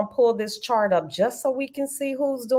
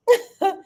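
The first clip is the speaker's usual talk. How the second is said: short high laugh; 11 semitones higher, 5 dB louder than usual. Real, not acted. Laughing at being a crybaby.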